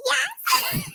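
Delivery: squeaky high chipmunk pitch; sped-up chipmunk sound; helium voice. Real, not acted. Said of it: "See, I do sound like the idiot chipmunk".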